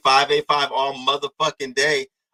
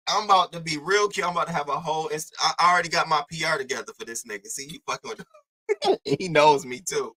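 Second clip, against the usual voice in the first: normal talking voice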